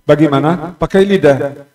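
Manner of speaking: normal talking voice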